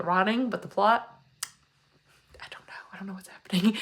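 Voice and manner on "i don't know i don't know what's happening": hushed, breathless whisper. Real, not acted. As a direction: drop to a confused whisper. Confused aside about the plot.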